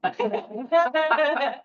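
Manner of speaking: high, playful mock laugh; imitated laugh. Wife laughing at her stressed husband, high playful laugh. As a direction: laugh lightly to defuse the tension. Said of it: "she looked at him and went".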